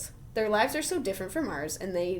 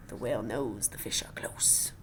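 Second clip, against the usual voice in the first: soft whisper, lower, voice off; dramatic whisper; 9 semitones lower, 5 dB quieter than usual. Acted. Reader whispering that the fish are close. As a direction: drop to a whisper for the payoff. Reading echolocation page to child.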